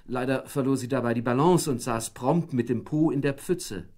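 usual speaking voice

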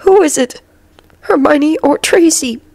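high, weary, halting question; slow, pained speech. A narrator's reading. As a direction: ask slowly, as if hurting. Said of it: "the professor spoke slowly".

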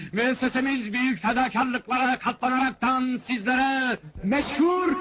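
high, ceremonious showman's pitch; high-pitched carnival announcer; barker's announcement. Acted. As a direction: announce grandly to a crowd of citizens. Fairground barker announces to the crowd.

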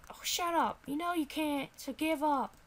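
high-pitched, scornful character; needling high little voice; dismissive sidekick voice. Acted. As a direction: tell the player off in a high voice. Character discourages the player.

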